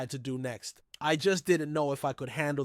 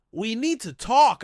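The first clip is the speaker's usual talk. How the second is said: shaky but firm; 6 semitones higher, 6 dB louder than usual. A narrator's reading. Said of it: "my voice shaking but firm".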